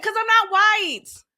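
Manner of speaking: ordinary delivery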